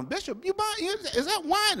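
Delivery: high, prying, scandalized mock voice; nosy church lady. Shopper spotting the bishop's wine, high and scandalized. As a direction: squeak out the question like a gossiping busybody.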